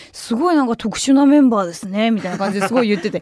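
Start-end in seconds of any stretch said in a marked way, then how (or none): 0.1-2.1 voiceless whisper; whispered question. Retold or imitated. Drunk boy whispers his question to the staff. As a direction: hush it like a secret.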